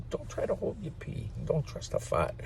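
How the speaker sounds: normal talking voice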